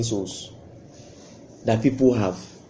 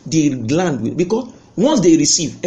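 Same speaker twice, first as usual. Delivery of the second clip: ordinary talk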